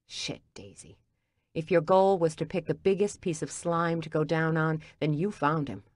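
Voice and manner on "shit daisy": whisper. Acted. Gordon reacts to shocking news.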